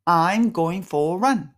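slow, clear English model line; slow model phrase. Shown as a demonstration. Teacher gives the last model for students to copy. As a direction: model it slowly again for repetition. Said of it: "repeat after me".

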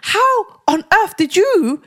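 high, slow, indignant demand; pissed-off retelling. Retold or imitated. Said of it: "I actually got really pissed off at her".

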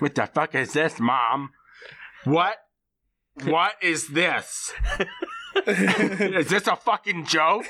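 hammed-up disbelief through laughs; overacted angry son; mock outrage. [name] outraged at gift.

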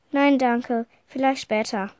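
ordinary delivery

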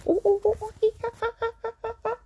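high, rapid monkey hoots; playful "ooh ah" monkey chatter; monkey noises. Shown as a demonstration. Parent asking toddler where's your monkey, high monkey imitation.

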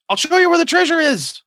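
high, loud squeaky goblin offer. Acted. Lone goblin offers the party treasure, squeaking high. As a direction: squeak it out like an eager little goblin. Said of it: "he squeaks and points at you".

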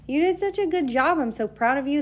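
proud, encouraging hype voice; supportive cheering. Pretend. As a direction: cheer on a friend, pitch lifted. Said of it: "I'd be in their corner like".

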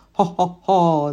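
slow, loud hooting cackle; ho-ho like a gleeful witch. A narrator's reading.

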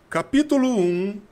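high, loud, slow chapter announcement; hammed-up chapter-title reading; mock audiobook intonation. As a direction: announce it like an overblown audiobook narrator. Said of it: "usa uma certa entonação".

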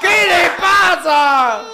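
high, very loud, stretched-out challenge; mock outraged shout. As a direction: bellow it slowly, hamming up the outrage. Imita al presentador increpando al pariente. (grito indignado, exagerado y alargado)

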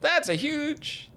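high, slow amazed exclaim; mock movie line. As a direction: draw it out, high and awed. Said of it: "people were like".

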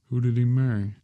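urgent hoarse question; hoarse demand. A narrator's reading. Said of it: "he demanded hoarsely".

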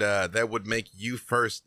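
ordinary talk, nothing marked

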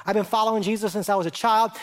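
ordinary talk

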